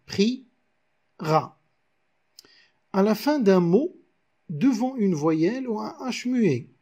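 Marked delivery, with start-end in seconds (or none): 0.1-1.6 "pri" then "ra", each syllable set apart; pri, ra, deux syllabes détachées; syllables counted out. Shown as a demonstration. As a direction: split "pri, ra" into two separate beats. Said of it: "seulement deux syllabes ici".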